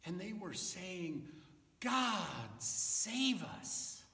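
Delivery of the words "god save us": high, restrained cry to God; mild cheer. Acted. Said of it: "as a way of cheering for God".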